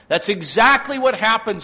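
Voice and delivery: high, loud and emphatic; loud preaching. Acted. Preacher driving home the fall to the congregation, loud. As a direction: hammer the point home. Repeated line, loud then quiet.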